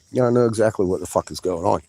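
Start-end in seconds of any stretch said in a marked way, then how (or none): none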